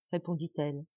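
ordinary delivery, nothing marked

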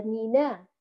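مينا with a clean open a at the end; correct reading. Shown as a demonstration. Teacher corrects the students, clean مينا. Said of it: "لا، هي".

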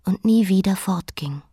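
a final whispered line; whispered close. Acted. The queen whispers the end of her own story. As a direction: let it die away in a whisper.

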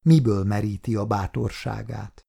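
plain reading voice

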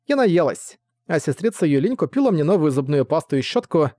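a happy little daughter chattering; little girl voice. Acted.

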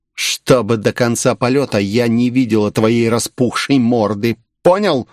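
usual speaking voice

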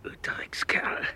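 slow, breathed insult; whispered curse. Acted. Victim cursing the fled gunman, whispered. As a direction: hiss it under your breath.

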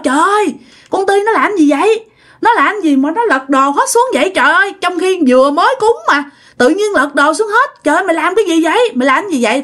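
sửng sốt la lên. Acted. (sửng sốt, kêu trời)

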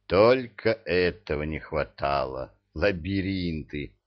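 ordinary delivery

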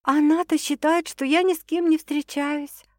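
high-pitched worried explanation; puzzled. A narrator's reading. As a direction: point out the snag, perplexed. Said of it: "озадаченно покачала она головой".

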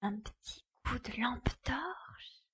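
hushed, breathy whisper while sneaking in; whispering inside a stranger's house. Acted. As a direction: keep it to a whisper so no one hears. Sneaking into the dark dome.

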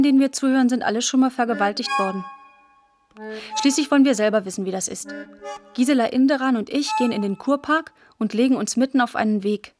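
ordinary talk, nothing marked

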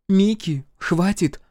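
high, firm order to stop; scolding sister. A narrator's reading. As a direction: cut off a little brother's tantrum. Sister tries to calm brother.

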